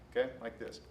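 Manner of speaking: usual speaking voice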